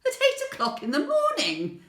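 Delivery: high, loud, scandalised exclamation; indignant. Acted. Pensioner protesting the early shopping hour, indignant. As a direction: exclaim it as an outrageous imposition.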